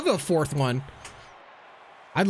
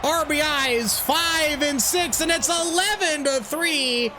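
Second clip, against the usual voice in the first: baseball announcer play-by-play; 7 semitones higher, slower than usual. Acted. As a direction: call the game like a broadcaster. Plays announcer in game.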